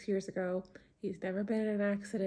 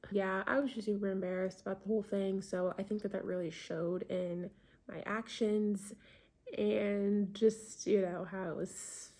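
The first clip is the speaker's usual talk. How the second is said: ordinary talk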